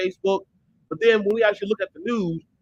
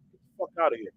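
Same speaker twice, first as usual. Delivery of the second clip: ordinary delivery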